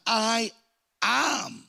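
"I am" pitched high, a pause splitting the two words; emphatic announcement. Acted. Jesus announcing who he is, emphatic "I am" with a pause. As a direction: declare "I", pause, then "am", both high. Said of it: "He is announcing who he is".

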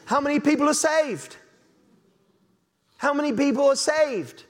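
a sceptic doubting revival, openly incredulous; sceptical challenge. Retold or imitated. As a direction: challenge the claim with disbelief. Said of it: "You say".

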